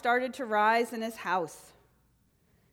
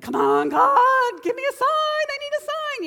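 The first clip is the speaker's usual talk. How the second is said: frantic pleading; 13 semitones higher, 9 dB louder than usual. Retold or imitated. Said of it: "And he's like".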